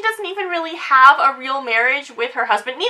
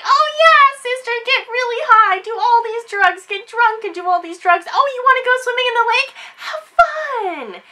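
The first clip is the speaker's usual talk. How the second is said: much higher, sing-song fake-cheery voice; mocking cheerful [name]; 8 semitones higher than usual. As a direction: egg her on with syrupy fake glee. [name] mockingly eggs sister on.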